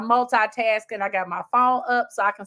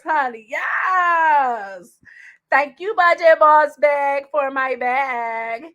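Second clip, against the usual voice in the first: excited; 4 semitones higher, 4 dB louder, much slower than usual. Real, not acted. Joyful thanks to the seller.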